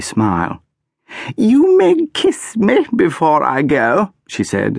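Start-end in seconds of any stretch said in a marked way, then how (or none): 1.4-4.1 high, slow, grandly condescending. A narrator's reading. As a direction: grant a favour from on high. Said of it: "as if it was a great favour".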